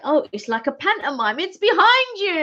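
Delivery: pantomime "It's behind you" call, high and loud; playful pantomime shout; panto call. Retold or imitated. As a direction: belt it out like a panto audience. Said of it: "And I was like".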